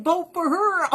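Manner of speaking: high, mocking voter voice. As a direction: parrot it like a clueless voter. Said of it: "And everyone's like".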